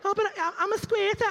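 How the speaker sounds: high mocking whine. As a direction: mimic her whining high. Mocking woman's excuse.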